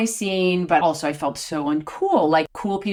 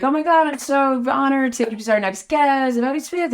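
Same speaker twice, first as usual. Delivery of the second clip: gushing host intro; 7 semitones higher than usual. Retold or imitated. Onstage introduction, acted out.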